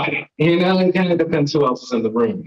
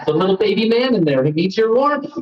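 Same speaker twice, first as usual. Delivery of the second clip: put-on baby voice; 7 semitones higher than usual. Stand-up bit, baby-man voice.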